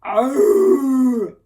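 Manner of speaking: loud roaring rhino snort. A narrator's reading. Reggie warning the buffalo herd with a loud snort. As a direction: blast it out as a warning. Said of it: "he gave a loud snort".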